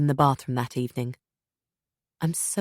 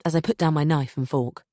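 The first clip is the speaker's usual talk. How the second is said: ordinary delivery, nothing marked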